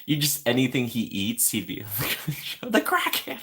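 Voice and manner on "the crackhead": imitating Jack Skellington. Jack voiced again, laughing.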